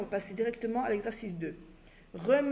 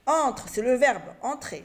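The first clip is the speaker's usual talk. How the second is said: ordinary delivery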